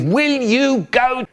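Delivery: drawn-out, high-pitched exasperated yell; mock shout. Older brother ordering a stubborn sibling to bed, mock shout. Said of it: "He'd shout, he'd rant and rave".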